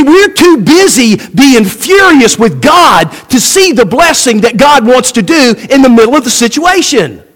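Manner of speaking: normal talking voice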